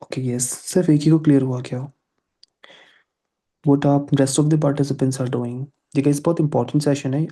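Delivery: usual speaking voice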